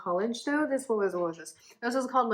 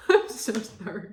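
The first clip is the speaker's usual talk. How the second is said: laughing apology; 8 dB louder, slower than usual. Real, not acted. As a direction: apologise through a loud laugh.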